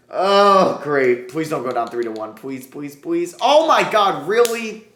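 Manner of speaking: high, loud desperate begging; exasperated pleading. Real, not acted. Gamer falling behind in the series, exasperated loud pleading. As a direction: beg the game not to do this.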